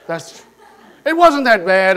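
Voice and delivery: words spilling with laughter; laughing. Real, not acted. Preacher laughing while retelling the cop. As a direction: laugh through the punchline.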